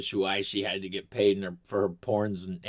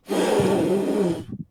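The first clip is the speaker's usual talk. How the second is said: long, high exhale into the breathalyzer; mock breathalyzer blow; 9 semitones higher, 5 dB louder than usual. Telling the cop story, hammed-up hard exhale. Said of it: "I go".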